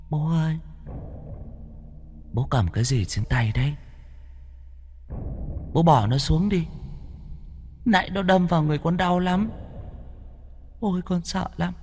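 high, soft, whimpering plea; eerie pleading child. A narrator's reading. Demon son begs his father to drop the statue, high and soft. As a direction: lure the father like a scared child.

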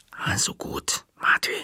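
sharp whispered hiss; grudging, hissing agreement. A narrator's reading. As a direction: give in through a hissed whisper. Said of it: "zischte ich".

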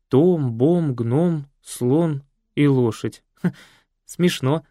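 drawn-out names, amused; slow amused reading. Acted. Екатерина slowly reads the names off the photo, amused. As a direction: read each name slowly, amused.

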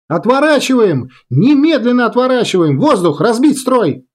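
high-pitched shouted orders; urgent alarm. A narrator's reading. Girl ordering bombers to turn away, urgent alarm. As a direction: sound the alarm and break the formation.

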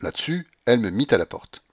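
ordinary delivery

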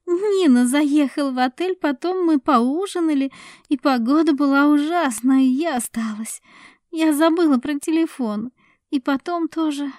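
lightly voiced blushing woman; flustered woman. A narrator's reading. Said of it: "стараясь разрядить обстановку".

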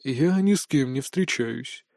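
low, slow, final refusal; cold, measured rebuff; cut him off. A narrator's reading. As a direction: shut down all argument quietly. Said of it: "отрезал куратор таким тоном".

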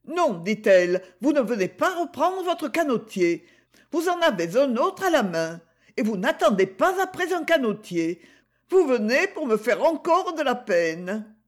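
lightly voiced young Gigi, protesting; Gigi, young girl. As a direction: play the girl, defiant and earnest. Gigi confronts Gaston.

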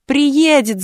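put-on high, loud child's shout. A narrator's reading. Girl shouting back at the taunting boy, loud. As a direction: yell it back defiantly. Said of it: "закричала Галя".

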